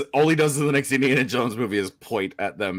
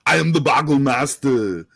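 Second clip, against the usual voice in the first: louder, a put-on Arnold movie-villain voice; Arnold impression; 6 dB louder than usual. Quizmaster announcing the category, in a loud Arnold voice. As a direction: boom it out like the Terminator. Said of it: "that was a decent Arnold, um, impersonation".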